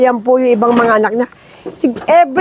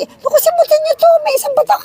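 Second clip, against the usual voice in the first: high, fast excited chatter; high rushed delight; excited squeal; 13 semitones higher, much faster than usual. Real, not acted. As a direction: squeal it out in a rush. Excited watching goats suckle.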